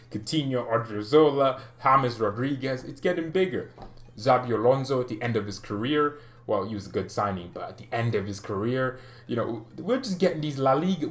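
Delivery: usual speaking voice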